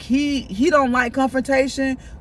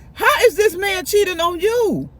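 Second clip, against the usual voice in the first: incredulous; 7 semitones higher than usual. Retold or imitated. Mock confronting the deluded friend.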